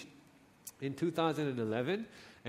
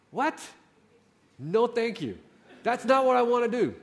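sharp, louder no-thank-you, higher; indignant protest; 6 semitones higher, 6 dB louder than usual. Retold or imitated. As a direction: push back hard and loud. Angry refusal of the message.

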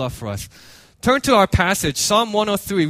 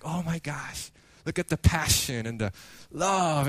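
hushed awe; 2 semitones lower, 8 dB quieter than usual. Retold or imitated. Moved viewers reacting to the runner's passion, hushed awe. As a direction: quietly voice a moved onlooker.

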